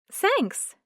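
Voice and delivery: a bright high thanks; Carly's voice. A narrator's reading. Carly thanking Bran for the compliment, lightly voiced.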